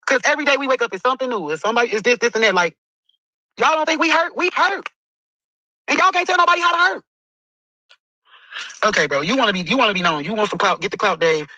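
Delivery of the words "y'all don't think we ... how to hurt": raised pained voice. Real, not acted. Grieving cousin pleads to online critics, voice rising high. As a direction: voice climbing high with hurt.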